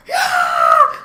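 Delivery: loud high mock scream; imitated scream. Retelling an upset boy's scream, loud mock scream. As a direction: belt out a short scream like an upset kid. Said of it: "Like, literally screaming".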